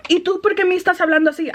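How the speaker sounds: high, quick mock challenge; indignant customer. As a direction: lightly imitate an affronted customer, fast and high. Retold customer challenging the manager.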